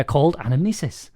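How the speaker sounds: ordinary talk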